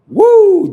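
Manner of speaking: very high, loud "Woo!"; big whoop. Real, not acted. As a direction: let out a piercing church whoop. Whoops before invoking Jesus.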